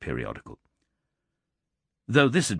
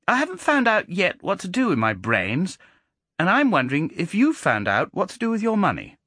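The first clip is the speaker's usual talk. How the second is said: put-on high, cheeky child's voice; a clever boy turning the tables; precocious boy; 8 semitones higher than usual. A narrator's reading. Boy John speaks.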